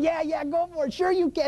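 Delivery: jeering crowd. Retold or imitated. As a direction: egg him on like gleeful vultures. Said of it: "And they're all going".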